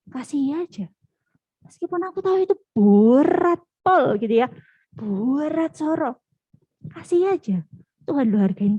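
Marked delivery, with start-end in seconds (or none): 2.8-4.2 "berat" drawn out and loud; emphatic stress. Real, not acted. Preacher admitting to youths it is hard, "berat" heavily stretched. 4.9-6.2 "Berat" stretched slowly at a higher pitch; drawn-out emphasis. Real, not acted. Preacher stressing the struggle to youths, "Berat" slowly drawn out. Repeating how hard it is.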